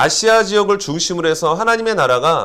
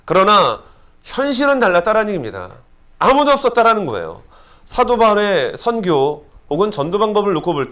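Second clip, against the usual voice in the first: fervent preaching; 7 semitones higher than usual. Acted. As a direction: preach it with rising fervor. Preaching at full force.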